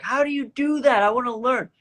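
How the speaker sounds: high-pitched, keen questioning; eager request. Retold or imitated. Duet partner to voice actor, eager to learn.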